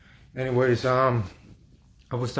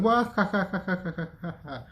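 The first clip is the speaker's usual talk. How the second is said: cartoon demon cackle; 7 semitones higher than usual. Demons mocking me as I wake, high cartoon cackle. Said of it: "they sounded like fucking cartoons".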